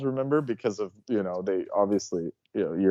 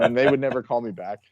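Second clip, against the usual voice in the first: ordinary delivery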